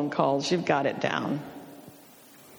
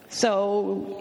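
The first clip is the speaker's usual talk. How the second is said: ordinary talk, nothing marked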